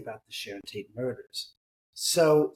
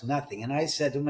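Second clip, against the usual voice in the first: normal talking voice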